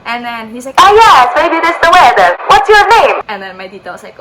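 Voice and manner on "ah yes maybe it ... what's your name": loud, high, hammed-up Italian accent; bad Italian accent. Italian stranger trying to cheer up tourist, exuberant Italian accent. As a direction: cheer someone up in booming mock Italian. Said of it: "My Italian accent is so bad".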